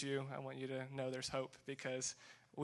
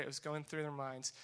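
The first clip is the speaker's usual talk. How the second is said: ordinary talk, nothing marked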